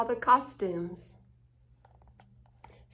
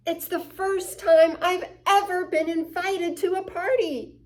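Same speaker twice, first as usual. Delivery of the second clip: excited; 7 semitones higher than usual. Acted. Charlie happy dance.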